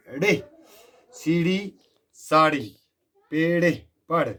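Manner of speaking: put-on teacher calling out अड़े, सीढ़ी, साड़ी for children to repeat, every ड़ sharp; clear pronunciation drill. Shown as a demonstration. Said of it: "इसकी जो pronunciation है". (ड़ वाले शब्द ज़ोर से, साफ़ उच्चारण में)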